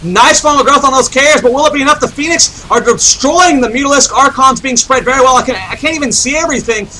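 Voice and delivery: high, driving esports casting; excited play-by-play. Real, not acted. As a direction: call the big fight with energy. Hyped play-by-play of big fight.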